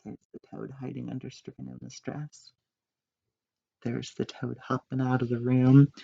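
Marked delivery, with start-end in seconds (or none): none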